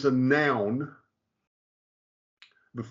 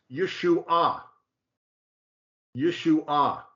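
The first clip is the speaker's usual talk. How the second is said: YESHUA as yeh-shoo-AH, stress on the final AH; third-syllable accent; 2 semitones higher, much slower than usual. Shown as a demonstration. Teacher showing the third word, YESHUA accented yeh-shoo-AH. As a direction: land YESHUA on a long final AH. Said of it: "Accent on the third syllable".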